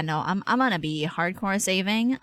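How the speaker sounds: ordinary talk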